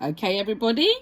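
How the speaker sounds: plain reading voice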